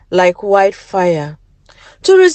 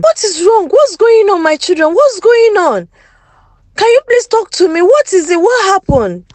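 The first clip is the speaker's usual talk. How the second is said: frantic parents; 9 semitones higher than usual. Acted. Parents rush to sick children.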